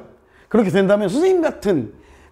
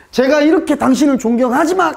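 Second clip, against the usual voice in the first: mock respectful preface; 6 semitones higher, 3 dB louder than usual. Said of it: "그래서".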